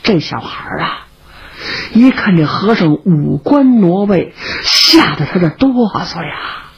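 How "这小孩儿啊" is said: usual speaking voice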